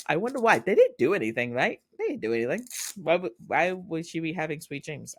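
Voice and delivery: high, playful fake innocence; mock puzzlement. Fan teasing about sweet dreams, mock puzzlement. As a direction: wonder aloud, pretending not to get it.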